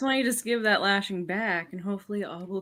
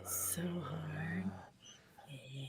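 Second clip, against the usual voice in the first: breathy whisper; 2 semitones lower, 12 dB quieter, slower than usual. Acted. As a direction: say it under your breath. Whispered aside.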